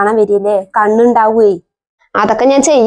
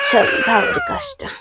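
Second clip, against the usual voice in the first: high, softer, slow fed-up groan; tired frustration at the endless crying; exasperated sigh; 7 dB quieter, slower than usual. Acted. (മടുപ്പോടെ, പതുക്കെ)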